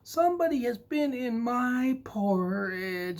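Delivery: put-on very high little baby bear voice; tiny high cub. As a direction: squeak like a tiny upset cub. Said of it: "said Baby Bear".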